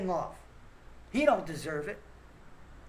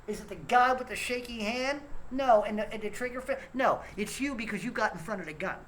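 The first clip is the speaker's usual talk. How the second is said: ordinary talk, nothing marked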